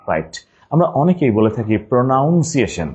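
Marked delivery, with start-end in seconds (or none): 1.9-2.9 "pronunciation" said pro-NOUN-ciation; mispronounced with "noun". Shown as a demonstration. Teacher quoting a common mistake, "pronunciation" with noun inside. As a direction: put an "ow" vowel in "pronunciation". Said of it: "it is not pronunciation".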